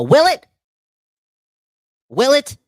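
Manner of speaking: loud, high mock disbelief; ranting at the fan's hope, a loud, high "will it?"; incredulous shout. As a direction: yell it back at the comment in disbelief. Mocking disbelief at comment.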